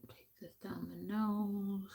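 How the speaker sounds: soft unvoiced whisper. To viewers, narrating makeup steps in a whisper. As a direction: whisper the step gently.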